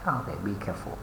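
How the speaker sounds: normal talking voice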